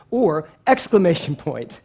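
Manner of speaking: ordinary talk, nothing marked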